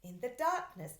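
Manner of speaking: plain reading voice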